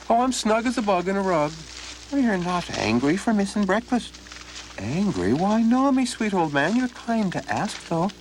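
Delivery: put-on butter up the old man; sly friendly stranger; cheery Donald. Donald feigns contentment.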